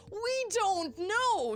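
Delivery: slow high drawn-out; drawn-out dramatic. Acted. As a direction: stretch it out dramatically.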